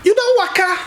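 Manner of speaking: high, excited pidgin banter; excited Urobo man. Retold or imitated. Urobo man at the Indian hospital greets a fellow Nigerian, excited and high. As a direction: cheerfully urge him to relax, pitched high. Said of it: "and the man said".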